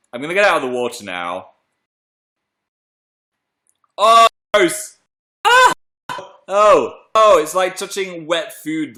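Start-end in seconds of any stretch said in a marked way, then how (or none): 4.0-9.0 high, loud yelps of disgust; grossed out. Real, not acted. Host touching the wet egg, grossed out. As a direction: recoil loudly from something slimy.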